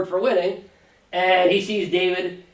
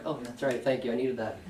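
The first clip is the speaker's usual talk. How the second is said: sheepish; 5 semitones lower, 13 dB quieter than usual. Retold or imitated.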